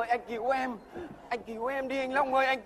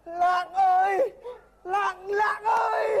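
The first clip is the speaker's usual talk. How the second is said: slow, higher, louder drawn-out wail of her name; desperate wailing call; 7 semitones higher, 4 dB louder, much slower than usual. Acted. A panicked suitor wailing his girlfriend's name down the phone.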